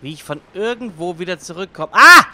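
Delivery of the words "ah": high, loud sudden scream; startled scream. Real, not acted. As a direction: shriek in fright. Sudden fright.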